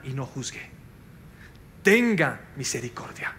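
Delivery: hushed whispered plea. Acted. Preacher urging the church to mercy, whispered. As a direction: breathe it out like a secret.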